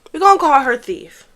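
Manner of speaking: normal talking voice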